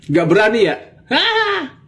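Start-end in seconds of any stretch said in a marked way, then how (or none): 1.1-1.7 mocking hah. Taunting challenge.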